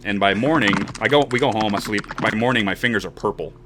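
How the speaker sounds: ordinary delivery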